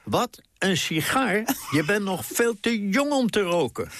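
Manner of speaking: high, indignant call down the stairs; mother mishears and yells down at her son; mother shouting down. Retold or imitated. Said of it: "schreeuwde naar beneden".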